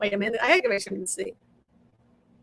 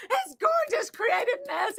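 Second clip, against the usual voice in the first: laughing; 11 semitones higher than usual. Real, not acted. Laughing at her messy studio.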